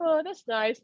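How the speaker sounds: mock unimpressed. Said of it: "that doesn't do anything".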